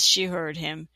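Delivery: plain reading voice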